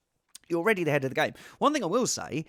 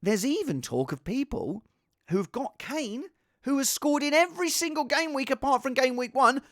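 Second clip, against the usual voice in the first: normal talking voice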